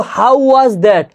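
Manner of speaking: "হাউ ওয়াজ দ্যাট" as three distinct English words; correct pronunciation. Shown as a demonstration. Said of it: "উচ্চারণটা কিন্তু". ("হাউ ওয়াজ দ্যাট" শুদ্ধ ইংরেজি উচ্চারণে)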